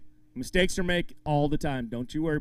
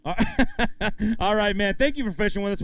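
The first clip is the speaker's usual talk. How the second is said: ordinary delivery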